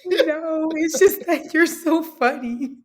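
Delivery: high giggly, slow and tipsy; giggling through the denial. Real, not acted. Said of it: "She drunk".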